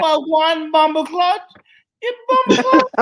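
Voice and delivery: loud, high-pitched Jamaican caricature; mock Jamaican accent. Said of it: "When people do Jamaican accents".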